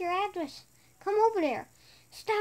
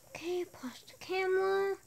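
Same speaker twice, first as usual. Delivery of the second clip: whispered aside; 10 dB quieter than usual. Real, not acted. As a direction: whisper it off camera.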